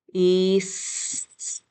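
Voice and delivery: east in slow pieces, long ee and s, separate t; sounded out. Shown as a demonstration. Teacher writing along with pupils, "east" sounded out in pieces. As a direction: pull the t away from a drawn-out "east". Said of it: "So say the sounds".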